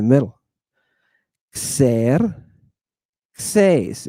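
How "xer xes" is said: Xerxes as "kser... kses", two parts with a gap; split into syllables. Shown as a demonstration. Teacher sounding out a Greek name for the class, syllable by syllable. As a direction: sound out Xer, Xes one syllable at a time. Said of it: "It's two syllables".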